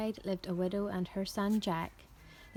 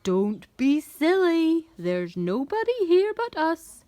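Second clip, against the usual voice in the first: scolding giantess; 7 semitones higher, 7 dB louder than usual. A narrator's reading. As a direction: scold the giant in a big swooping voice. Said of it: "the giantess scolded".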